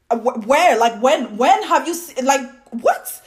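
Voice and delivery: loud, high, stammering disbelief; incredulous. Real, not acted. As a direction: sputter the questions in exasperation. Baffled retort to stereotype.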